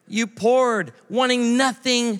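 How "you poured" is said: ordinary delivery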